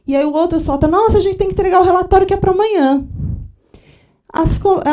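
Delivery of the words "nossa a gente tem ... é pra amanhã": fast, loud, anxious blurt; hurried blurt. Retold or imitated. Coworker blurting about the report at lunch, hurried and loud. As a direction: rush it out like a sudden panic.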